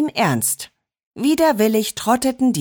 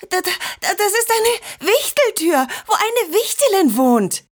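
aufgeregt; 13 semitones higher than usual. A narrator's reading. Kind entdeckt begeistert Wichteltür.

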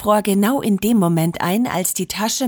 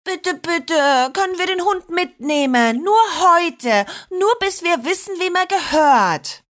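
put-on higher begging child's voice; children pleading with grandma, much higher; 10 semitones higher than usual. A narrator's reading. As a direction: beg grandma like little girls. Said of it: "flehten Liliana und Marinette".

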